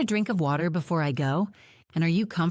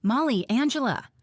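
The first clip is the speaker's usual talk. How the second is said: calling out; 7 semitones higher than usual. A narrator's reading. Jake calls down the hill to them, calling out. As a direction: call the names out across the hillside. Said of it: "a voice calls out".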